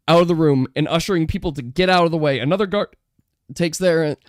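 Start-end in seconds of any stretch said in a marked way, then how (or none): none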